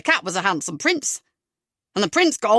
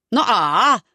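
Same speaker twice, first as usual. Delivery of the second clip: loud mock-stern correction; 6 dB louder than usual. Comedian correcting the audience's reply, loud. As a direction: tell the crowd off, loud.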